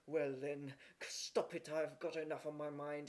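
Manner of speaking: grudging, grumpy cook; muttered, put-upon complaint; grumbling. A narrator's reading. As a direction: grumble it while giving in. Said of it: "grumbled the cook".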